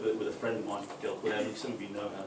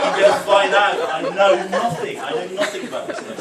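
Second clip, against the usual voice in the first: loud, high mock panic; terrified; 6 semitones higher, 12 dB louder than usual. Comic out of his depth with the mechanic, terrified. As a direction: blurt it out in exaggerated dread. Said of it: "Like, I'm looking terrified".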